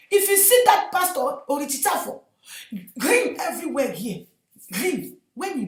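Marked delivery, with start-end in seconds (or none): none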